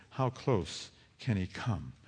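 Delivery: ordinary delivery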